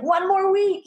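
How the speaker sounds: a sing-song squeak of self-encouragement; mock dieter cheering herself on. Dieter counting down the weeks, in a high mock cheer. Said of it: "You're like".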